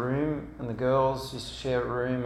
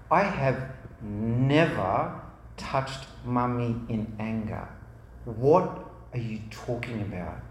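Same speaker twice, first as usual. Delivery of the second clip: firm denial; 3 semitones lower than usual. Retold or imitated. Dad denying he ever touched Mummy, firm. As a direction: spell it out sternly, word by word. Dad firmly denies the accusation.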